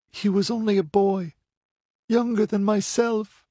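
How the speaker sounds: high, a touch of breaking grief; faltering voice. A narrator's reading. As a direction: voice giving way over her brother. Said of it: "Her voice faltered and broke".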